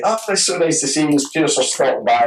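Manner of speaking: high, lightly voiced cheery welcome; cheerful host. Wife welcoming the uninvited guest, lightly voiced sunny cheer. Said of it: "came down happy as Larry".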